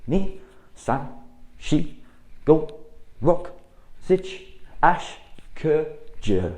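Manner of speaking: loud, high drill count; sharp dojo counting; called count. Shown as a demonstration. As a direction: bark each number to the class. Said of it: "a little bit quicker".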